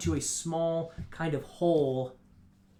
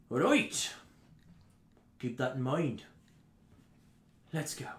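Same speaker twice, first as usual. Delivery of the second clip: lightly acted threat, dropping softer; curt intruder's warning; 3 dB quieter than usual. Raider leaving the dwarf with a warning, quietly menacing. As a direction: give a parting warning with long pauses. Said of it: "And he says".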